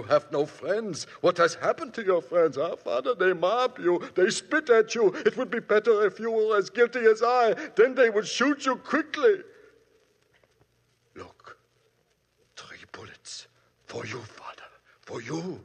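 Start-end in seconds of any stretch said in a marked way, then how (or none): 1.2-9.4 louder, faster, rising bitter taunt. Acted. Otto taunting the priest about his friends, louder and bitter. 11.2-15.6 very soft, low threat; menacing hush. Acted. Otto showing the priest three bullets, a soft low menace.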